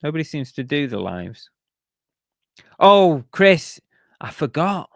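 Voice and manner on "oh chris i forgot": startled, loud "Oh, Chris"; suddenly remembering; sudden realisation. Real, not acted.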